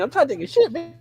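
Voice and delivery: squeaky high mock threat, pitch way up; retelling a movie fight as a squeaky tough guy. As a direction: ham up a tough guy in falsetto. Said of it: "you know what I mean?".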